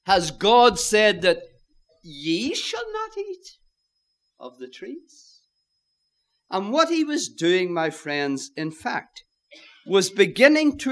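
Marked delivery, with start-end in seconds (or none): 0.1-5.4 "ye" swoops up very high in disbelief; emphatic YE. Acted. Devil doubting God's word to Eve, incredulous stress on YE. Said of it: "it's emphatic".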